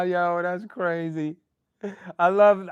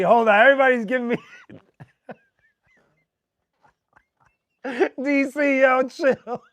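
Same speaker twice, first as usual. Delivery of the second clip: hiccup giggles; 5 semitones higher, 6 dB louder than usual. Real, not acted. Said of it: "Y'all are making me laugh with hiccups".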